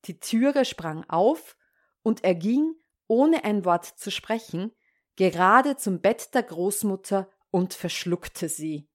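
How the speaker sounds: ordinary delivery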